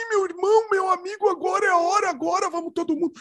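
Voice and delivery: high-pitched preacher's exhortation on one sustained tone; Universal Church pastor. Retold or imitated. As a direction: imitate a pastor rallying the congregation. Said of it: "todos os pastores lá da Igreja Universal falam assim".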